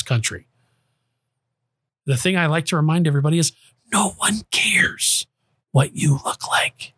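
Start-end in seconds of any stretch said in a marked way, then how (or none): 3.9-6.9 slow, whispered emphasis; half-voiced, drawn-out whisper; emphatic whisper. Real, not acted. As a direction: drive it home in a deliberate whisper. Driving home a point, whispered.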